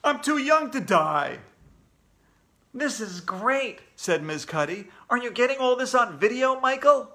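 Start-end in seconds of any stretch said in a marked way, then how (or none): 0.0-1.4 loud, raised wail; panicked yell. A narrator's reading. Kid fleeing the monsters, yelling. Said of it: "yelled somebody else". 2.7-3.8 slow, higher, relishing voice; delighted. Acted. Miz Cuddy thrilled at the footage, delighted. 5.1-7.1 loud, high, eager question; excited. Acted. Miz Cuddy to Michael with the camera, excited.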